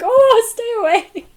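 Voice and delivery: high, loud scared shriek; frightened scream. Retold or imitated. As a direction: shriek it in panic, backing off. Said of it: "and he screamed".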